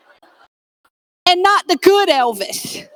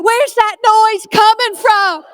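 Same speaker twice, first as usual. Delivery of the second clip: puzzled shout; 7 semitones higher than usual. Retold or imitated. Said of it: "People be like".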